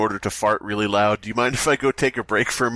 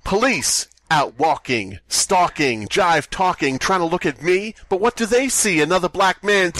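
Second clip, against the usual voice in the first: rhythmic, building, higher spoken verse; slam poetry; 4 semitones higher than usual. Pretend. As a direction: chant it like a slam poem. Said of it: "You go up there and you're like".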